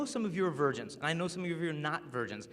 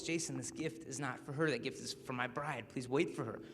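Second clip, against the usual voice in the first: inner voice; 3 semitones lower, 7 dB quieter than usual. Retold or imitated. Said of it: "the same voice".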